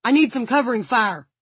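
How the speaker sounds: high, loud urgent call; shouting. A narrator's reading. Frank desperate for covering fire, shouting to his men. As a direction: shout for backup. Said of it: "he shouted".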